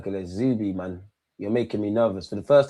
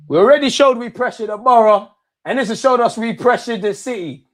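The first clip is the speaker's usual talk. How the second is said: loud and higher, gloating; triumphant loud boast; excited celebration; 8 semitones higher, 8 dB louder than usual. Real, not acted. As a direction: crow about the win. Celebrating the win.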